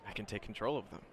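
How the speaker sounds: soft, guarded student; Shinso. Acted. Student defending his feared quirk, quiet and halting. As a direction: explain it quietly, hesitant and defensive. Game master voices Shinso.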